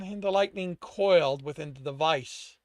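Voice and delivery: plain reading voice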